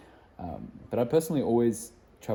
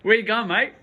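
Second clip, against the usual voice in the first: laughing mock Australian accent, higher and louder; 12 semitones higher, 9 dB louder than usual. Vlogger teases friend leaving for America in a laughing Australian accent. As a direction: ask it like a cheeky Aussie bloke, laughing. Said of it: "put on the Australian accent".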